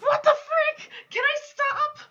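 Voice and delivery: high, loud exasperated whine; fed up, voice shooting way up; exasperated outburst. Real, not acted. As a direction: cry out in loud, high-pitched frustration. Exasperated by repeating game loop.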